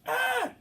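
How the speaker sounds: very high, startled shriek; girlish scream. Retold or imitated. Nurse screams in fright at the face in the window. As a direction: shriek like a frightened little girl. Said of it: "I s-, screamed like a little girl".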